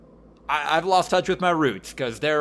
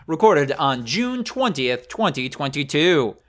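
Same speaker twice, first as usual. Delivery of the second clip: upbeat announcer read, bright and lifted; hammed-up radio host opening; podcast host intro; 6 semitones higher, 5 dB louder than usual. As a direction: welcome the listeners like a show host. Host opens the episode.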